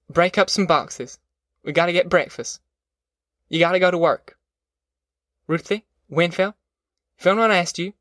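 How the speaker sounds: a mother taking charge, pressing; firm mother. Acted. Narrator voices Ma's orders.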